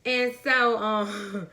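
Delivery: ordinary talk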